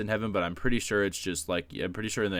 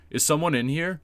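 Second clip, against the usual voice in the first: loud call; 7 semitones higher, 4 dB louder than usual. Retold or imitated. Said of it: "I just kinda hear a loud".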